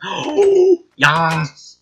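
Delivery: sudden high gasping oh; thrilled little gasp; excited gasp. Real, not acted. Excited gasp at slime door.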